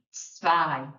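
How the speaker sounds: zwei with a crisp ts-v start; German zwei. Shown as a demonstration. Teacher modelling German numbers, zwei with tsv onset. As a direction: say zwei as tsvai. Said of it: "We've got".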